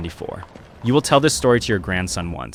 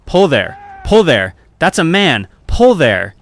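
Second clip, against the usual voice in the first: loud urgent shouts across the water; calling out; 8 semitones higher, 8 dB louder, slower than usual. A narrator's reading. Sailor in lifeboat shouting to rowers, loud urgent call. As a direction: bellow the order to pull for the man. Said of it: "a man calling".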